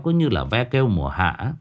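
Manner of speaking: usual speaking voice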